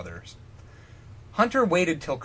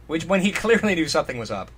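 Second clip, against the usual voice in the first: normal talking voice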